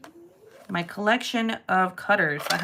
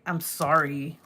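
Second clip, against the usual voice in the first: low, grudging mock apology; insincere apology; 4 semitones lower, 4 dB quieter, slower than usual. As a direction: imitate a kid's half-hearted sorry. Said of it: "like, little jackass".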